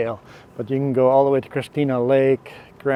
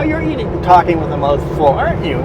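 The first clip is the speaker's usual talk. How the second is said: teasing; 7 semitones higher, 3 dB louder than usual. Real, not acted. Teasing wife caught eating.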